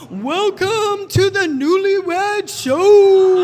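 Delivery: show MC. Retold or imitated. MC opening the Newlywed Show on a cruise, booming announcer. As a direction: announce the show to a packed room, stretching the words. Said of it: "M C came on the stage".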